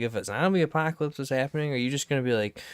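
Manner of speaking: ordinary talk, nothing marked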